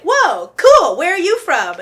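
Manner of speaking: loud, high, wowed reaction; light up at hearing a new accent; excited curiosity. Retold or imitated. Acts out delight at accent.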